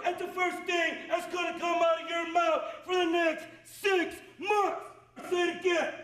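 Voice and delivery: barked orders at full volume; drill sergeant shouting. Real, not acted. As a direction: yell it like a drill instructor. Drill instructor yelling.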